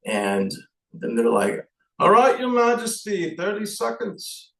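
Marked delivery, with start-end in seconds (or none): 2.0-4.5 high, bright mock stagehand voice pitched well up; stagehand's cue. Stagehand calling the nervous king to the mic, high mock voice.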